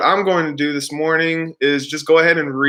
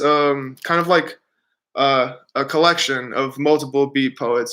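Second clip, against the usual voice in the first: usual speaking voice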